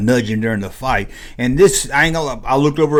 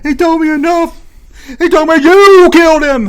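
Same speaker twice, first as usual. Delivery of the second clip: impression of Luke; 9 dB louder than usual. Luke calling down in movie.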